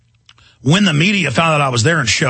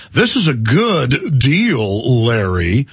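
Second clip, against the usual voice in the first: drawn-out mock Southern politician; fake Southern accent; 3 semitones lower, much slower than usual. Politician selling a trade deal to Larry, fake Southern accent. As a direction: stretch it out in an exaggerated Southern accent. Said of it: "fake Southern Nelly accent here".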